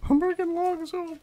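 sing-songy goofy repeat; silly high voice. Pretend. Player announcing to friend he's bringing logs, in a goofy high voice.

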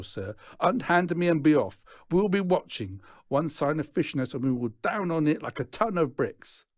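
a gang leader warning his lads; pompous Clarence P. Acted. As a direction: lecture the lads grandly.

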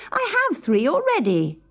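high, spoiled and irritated; cross princess. A narrator's reading. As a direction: snub the gifts like a bored princess. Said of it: "said the princess crossly".

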